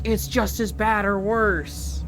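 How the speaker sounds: slow, drawn-out imitation of naysayers; mock alarmist. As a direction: parody people condemning it. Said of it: "the way I see people talk about 'em".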